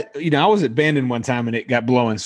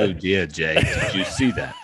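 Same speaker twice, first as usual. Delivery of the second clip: lower, imitated South African accent; lightly put-on South African, with a laugh; 8 semitones lower than usual. Listener mimics the golfer laughing about his botched shot, South African accent. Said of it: "in his South African accent".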